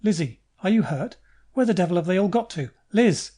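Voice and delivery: rising alarmed call ending in a yelled "Liz!". Acted. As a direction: shout for her across the room. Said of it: "Someone is calling".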